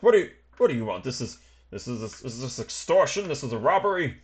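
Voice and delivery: stammering, mildly acted alarm; flustered protest. Trapped passenger to his captors, flustered protest. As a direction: stumble indignantly into the accusation.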